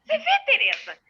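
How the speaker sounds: high-pitched, pointed public complaint; imitated indignant, carrying voice; talking loudly. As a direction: say it for everyone around to overhear. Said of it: "e ela fala alto".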